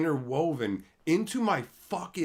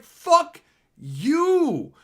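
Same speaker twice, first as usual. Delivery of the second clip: drawn-out angry curse; 11 semitones higher, 8 dB louder than usual. Real, not acted. Peak of rant.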